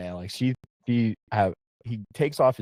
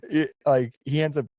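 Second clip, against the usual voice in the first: laughing; 7 semitones higher than usual. Real, not acted. Laughing while telling.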